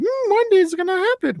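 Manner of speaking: high, dreamy musing hum; hope against hope, sing it wistfully; dreamy high voice of a fan hoping for a game. Retold or imitated. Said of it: "and we're like".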